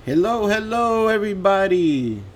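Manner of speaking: slow, high, loud on-air welcome; radio host greeting. Acted. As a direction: stretch it out like opening a late-night show. Radio host opening the show.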